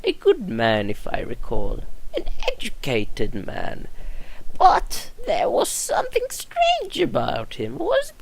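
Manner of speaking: put-on a frail old woman remembering; old nurse voice.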